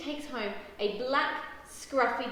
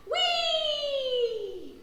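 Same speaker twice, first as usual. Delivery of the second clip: long, high, loud squeal; gleeful whee; 6 dB louder than usual. A narrator's reading. Jube and puppy splash in baths, squealing gleefully. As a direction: squeal with glee, sliding down at the end.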